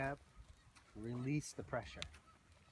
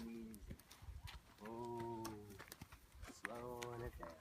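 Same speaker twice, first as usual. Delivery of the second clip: soothing slow-down; 2 semitones lower, 12 dB quieter than usual. Shown as a demonstration. Trainer easing a trotting horse down, soothing. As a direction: ease the horse down, quietly. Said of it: "start bringing my voice down".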